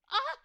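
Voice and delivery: sudden high shriek of fright; startled scream. Acted. Narrator startled by her phone buzzing, shrieking. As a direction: jump out of your skin with a yelp. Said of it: "I nearly toppled off my chair".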